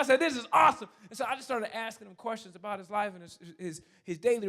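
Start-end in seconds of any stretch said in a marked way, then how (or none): 0.2-0.9 high, loud celebration; excited shout. Retold or imitated. Celebrating a new friend's fitness win, excited shouting. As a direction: cheer at full volume.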